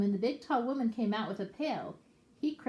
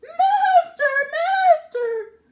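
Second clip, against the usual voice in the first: very high, loud shrill cry of a magic harp; 16 dB louder, much slower than usual. Acted. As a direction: cry for the master in alarm. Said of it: "the harp called out".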